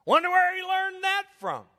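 high, loud mock wife voice, pointed; mock wife jab, hammed up and high; imitating the wife. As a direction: toss it off like a wife's dig, pitched high. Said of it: "she's like".

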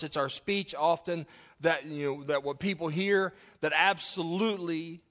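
loud, high-pitched pulpit preaching; fired-up Baptist preacher; preaching at full force. Real, not acted. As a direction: drive the point home to the church.

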